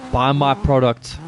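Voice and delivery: usual speaking voice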